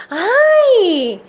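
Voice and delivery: high, loud "ai" yelp; boy's cry of pain. A narrator's reading. Boy who has just fallen, crying out in pain. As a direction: cry out sharply as the hurt boy. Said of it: "o menino gritou".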